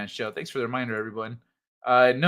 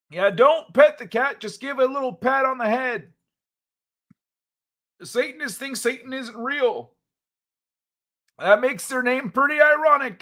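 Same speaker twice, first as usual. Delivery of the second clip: higher, lighter younger version of himself; youthful voice, raised pitch; 7 semitones higher than usual. Acted. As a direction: play his own younger self, pitched up. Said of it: "back to young me".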